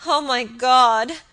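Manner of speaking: slow, loud anguished cry. Acted. Kneeling girl crying to heaven, slow anguished cry. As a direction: draw out the cry in horror. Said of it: "she cried".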